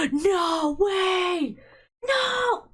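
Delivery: gasping, loud high shout of disbelief; shocked gasp. Real, not acted. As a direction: blurt it out in total shock. Shocked reaction to game reveal.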